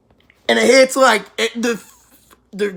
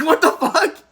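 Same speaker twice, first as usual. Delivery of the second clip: higher, incredulous line with laughter in it; laughing disbelief; 7 semitones higher than usual. Retold or imitated. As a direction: say it like you can't believe it and are laughing. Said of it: "I'm like".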